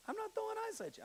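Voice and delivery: high, pleading junior-high voice; a hint of a nervous kid. A kid protesting to a stranger yelling about ice, high and pleading. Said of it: "I'm like".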